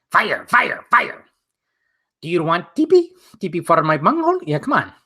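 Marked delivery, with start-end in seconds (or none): 0.1-1.2 strained cartoon Beavis chanting fire; hammed-up Beavis yelling fire, fire; Beavis impression. Doing Beavis for the stream, a strained cartoon voice. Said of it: "I can do a Beavis impression". 2.2-4.4 a light cartoon Beavis asking; lightly voiced Beavis. Offering TP to chat, a light Beavis voice. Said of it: "I can do a Beavis impression".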